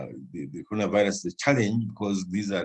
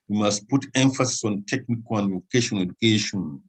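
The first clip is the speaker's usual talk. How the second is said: normal talking voice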